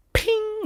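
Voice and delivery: high, sharp imitated bullet ping; a quick vocal sound effect of a bullet hitting steel; mock ping. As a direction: narrator makes a high ping as the bullet strikes. Gunshot sound effect in retelling.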